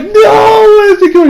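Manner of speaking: high, loud, slow panicked wail; a boy near tears, shrieking in despair; panicked cry. Retold or imitated. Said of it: "He starts crying".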